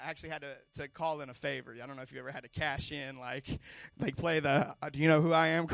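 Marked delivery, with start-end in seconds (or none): 4.9-5.7 loud, puffed-up mock demand, playing the big shot; mock self-important. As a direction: throw your weight around, jokingly. Playing the important-person card.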